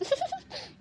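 quick high hushed snicker; gloating giggle. Acted. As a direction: snicker quietly at her misfortune. Gloating laugh in aside. (ಗುಟ್ಟಾಗಿ ಕೀಟಲೆಯ ನಗು)

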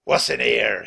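loud, demanding pirate voice; bullying villain question; gruff pirate captain. A narrator's reading. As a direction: demand an answer like a pirate boss. Pirate captain grabs Jack's backpack.

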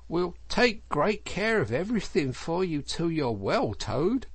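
high, sing-song nursery reassurance; solicitous Mole. Acted. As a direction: humour a patient sweetly.

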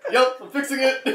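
loud, high-pitched comic shout; mock mechanic. Car tuner banging with a wrench, mock shout. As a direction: call it out over your own banging.

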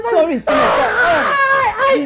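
high, slow, crying plea of sorry; panicked apology. Acted. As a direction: beg forgiveness in panic. Panicked apology over sick man.

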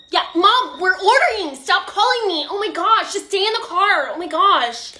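high, loud whine at mom; exasperated teen. Acted. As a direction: snap into the phone, hammed-up annoyance. Teen snaps at mom on phone.

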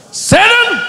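a sudden, high, loud shout; rebuke the devil. Real, not acted. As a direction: bark it straight at the devil. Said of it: "rebuking Satan".

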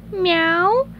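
high snail meow, rising; Gary's contented meow. Acted. Snail savoring a belly scratch, high contented meow. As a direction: mew with content, high and small. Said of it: "Gary said contentedly".

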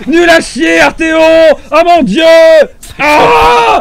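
slow, loud, crying-out shout; anguished yelling. Real, not acted. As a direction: wail it out loud in real despair. Raging at death in game.

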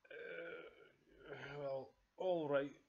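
slow, drawn-out giving in; reluctant Father Bear. Acted. Father Bear gives in about the chair, slow and reluctant. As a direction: hem and haw, then loudly concede.

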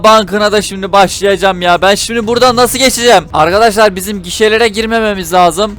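usual speaking voice

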